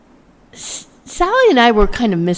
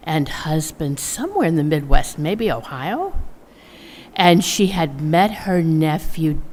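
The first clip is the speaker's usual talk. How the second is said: ordinary delivery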